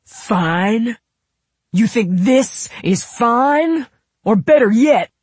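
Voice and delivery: incredulous, high, loud outrage; furious shouting, drawn out with disbelief; explosive anger. A narrator's reading. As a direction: throw the word back, then blow up. His fuse is lit, he explodes.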